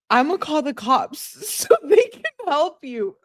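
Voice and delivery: high, giggly read with a laugh breaking the line; real laughter spilling into the quote; reading her text while cracking up. Real, not acted.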